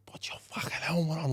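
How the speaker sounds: breathy hissed whisper, high; mock yell done as a whisper; whispered mock scream. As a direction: hiss the order instead of yelling it.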